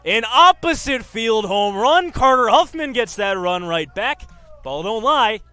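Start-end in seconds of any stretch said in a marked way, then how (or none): none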